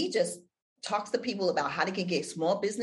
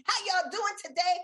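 excited greeting; 12 semitones higher than usual. Shown as a demonstration. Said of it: "I come in with a song".

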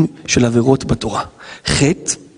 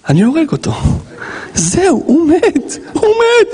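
mock murderous rage; 13 semitones higher, slower than usual. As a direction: pitch climbing to a high cry. Acted vengeful thoughts of humiliated worker.

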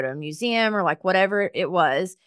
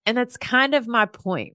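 usual speaking voice

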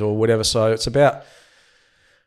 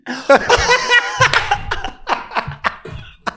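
big laugh. Real, not acted. Laughing at his own blunder.

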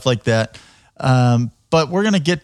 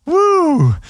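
long, high "woo"; drawn-out woo, way up high; high whoop; 3 dB louder than usual. Real, not acted. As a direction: let out a high-pitched whoop. Host reacts to outrageous answer.

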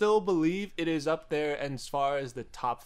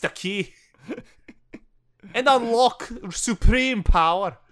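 mock-solemn Scottish lecture on the keyblade; Scottish accent; 5 semitones higher, 4 dB louder than usual. Scottish guy jokingly explaining to his co-host, in a thick Scottish accent.

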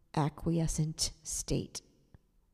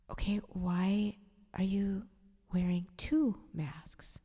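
slow, hushed, careful question; gentle whisper; slower than usual. Retold or imitated. Careful question to fragile woman.

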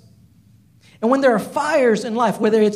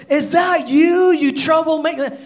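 angry shout; 7 semitones higher, 5 dB louder than usual. Retold or imitated. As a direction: yell the accusation across at him. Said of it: "he asked the question".

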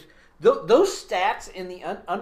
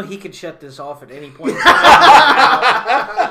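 laughing; 3 semitones higher, 14 dB louder, slower than usual. Real, not acted. Laughing while joking about host.

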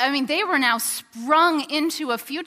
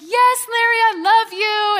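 much higher, louder, slow joyful cry; excited yes; 9 semitones higher, 8 dB louder than usual. Retold or imitated. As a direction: shout your yes with overflowing joy. Mary accepts proposal.

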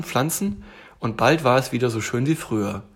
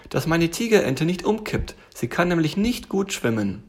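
little tiger voice, pitched up; worried little tiger; 6 semitones higher than usual. Acted. Tiger warns bear about his tiger duck, worried.

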